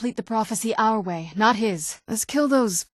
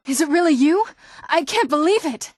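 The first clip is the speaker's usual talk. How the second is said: astonished reunion; 9 semitones higher than usual. Acted. Reunion with long-lost mother.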